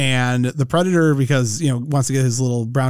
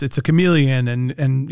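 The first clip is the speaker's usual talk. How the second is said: usual speaking voice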